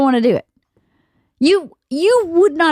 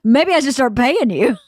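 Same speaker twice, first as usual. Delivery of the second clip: ordinary talk, nothing marked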